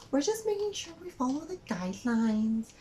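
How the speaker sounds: high, mock-prim voice; fussy cashier. Cashier defends guidelines to mom, mock prim.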